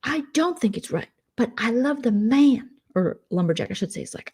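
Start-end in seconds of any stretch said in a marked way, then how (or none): 0.0-2.7 high, sappy imitation of Maria; mocking Maria. Imitating Maria defending her man in a high coo. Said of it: "And Maria's like".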